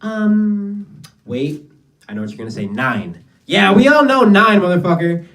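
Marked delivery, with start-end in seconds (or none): 3.4-5.3 loud exasperated jab at the crowd; mock yell. Mocking a heckler who only knows one German word, loud. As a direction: shout it at the heckler like it's obvious.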